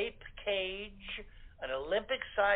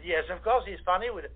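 playful French accent imitation; mock French accent. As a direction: answer in a hammy French accent, Clouseau style. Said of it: "So we all spoke with French accents".

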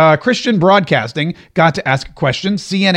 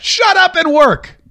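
high mock whine; 10 semitones higher, slower than usual. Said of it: "mocking reporters".

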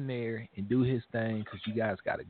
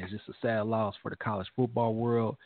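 usual speaking voice

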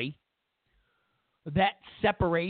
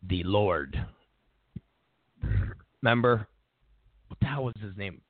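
ordinary talk, nothing marked

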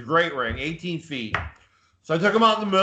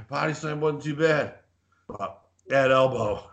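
imitating winded fan. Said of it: "he's like".

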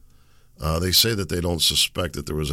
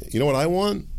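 ordinary talk, nothing marked

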